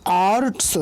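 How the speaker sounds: आर्ट्स stretched, with a long आ and clear र; drawn-out pronunciation. Shown as a demonstration. Teacher showing the half letter, आर्ट्स drawn out. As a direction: draw out आर्ट्स sound by sound. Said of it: "क्योंकि".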